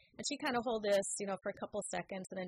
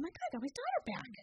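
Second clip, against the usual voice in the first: higher and rushed, overjoyed; overjoyed relief; 11 semitones higher, much faster than usual. Retold or imitated. Mother recalling the moment her daughter came back, overjoyed. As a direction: blurt it out in a rush of relief.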